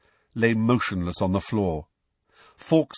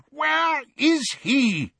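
much higher, slow drawn-out cry; frantic repeated shout; yelled again; much slower than usual. A narrator's reading. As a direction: call it out long and high. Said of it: "yelled Fudge".

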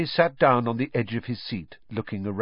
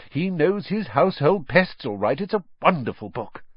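higher, lilting gush; beaming admiration; 6 semitones higher than usual. A narrator's reading. Mother praising her favourite author to her family, gushing. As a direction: sing their praises like a smitten fan. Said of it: "beamed down at him".

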